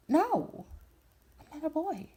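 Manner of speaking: high, insistent retold denial; high-pitched protest. Retold protest at being called boy.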